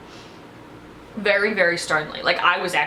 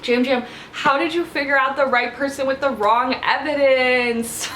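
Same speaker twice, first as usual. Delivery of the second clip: mock lament; 5 semitones higher than usual. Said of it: "So tragic".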